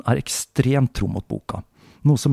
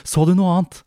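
higher and louder urgent question; pressing the boy for more; anxious mother; 9 semitones higher, 5 dB louder than usual. Acted. (engstelig mor, høyere og sterkere)

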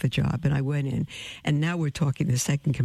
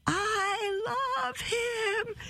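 retold shout across the diner; 5 dB quieter, much slower than usual. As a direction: stretch it slowly and high as if calling across a room. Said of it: "I shouted across the whole restaurant".

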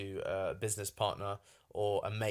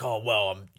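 loud put-on anger, cut short; mock angry; 7 dB louder than usual. Shown as a demonstration. Showing how anger comes across in voice notes, mock angry. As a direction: burst out in a quick demo of temper. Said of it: "hear me get really angry".

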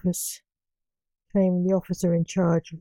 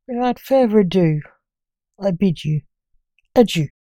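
ordinary delivery

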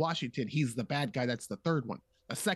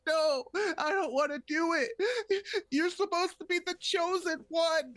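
much higher, slow, anguished refusal; mock pleading Obi-Wan; slower than usual. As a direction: beg him like a broken mentor. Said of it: "Obi-Wan can be like".

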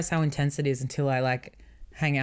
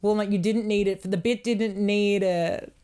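slow mock-scolding; 4 semitones higher, 3 dB louder than usual. Real, not acted. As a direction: drag it out, scolding playfully. Slow, far, teasing.

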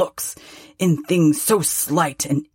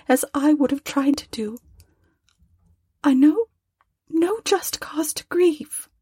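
put-on higher, gentle voice of a grieving young woman; young girl, sorrowful; 10 semitones higher than usual. A narrator's reading. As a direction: lift into a tender girl's voice, close to tears.

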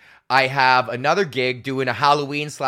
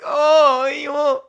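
high mock-reluctant wail, starting loud; hammed-up high-pitched protest; put-upon prostitute; 12 semitones higher, 5 dB louder, much slower than usual. Comic as weary prostitute told of more customers, mock protest.

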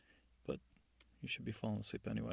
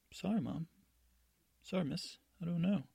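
higher-pitched, lightly acted apology; apologetic guard; 7 semitones higher than usual. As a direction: plead ignorance in a higher voice. Guard apologises to the imprisoned godmother.

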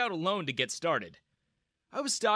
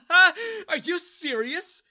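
high, loud mocking laugh into the words; laughing in derision; 9 dB louder, much slower than usual. A narrator's reading. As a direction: burst out laughing at the customer. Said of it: "Daniel started laughing".

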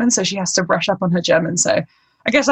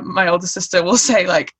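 usual speaking voice

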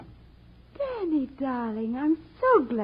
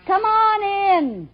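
ordinary delivery

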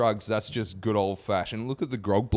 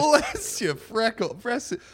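high, slow and sing-song; high silly catchphrase; 12 semitones higher, slower than usual. Acted. Savouring the new catchphrase with co-host, high sing-song.

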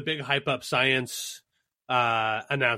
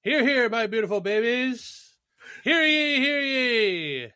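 town crier; 9 semitones higher, slower than usual. Acted. L's grand town crier entrance.

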